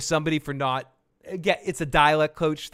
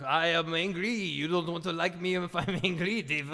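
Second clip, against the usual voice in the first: hammed-up French accent; silly French accent for a monster; French Hulk. Riffing on a French superhero, in a mock French accent. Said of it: "I am the French Hulk".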